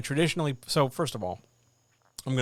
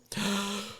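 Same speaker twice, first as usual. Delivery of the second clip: mock gasp; 8 semitones higher than usual. Gasp before spicy question.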